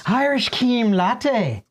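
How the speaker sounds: slow, drawn-out Irish accent on "Irish cream latte". Shown as a demonstration. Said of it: "I feel like he should pronounce it like this".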